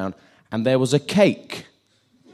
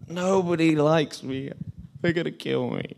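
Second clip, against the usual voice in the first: mock whining; 5 semitones higher, 3 dB quieter than usual. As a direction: whimper it in hammed-up misery. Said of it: "and whines".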